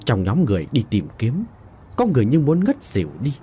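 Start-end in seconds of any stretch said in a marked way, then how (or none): none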